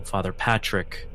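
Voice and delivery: ordinary talk, nothing marked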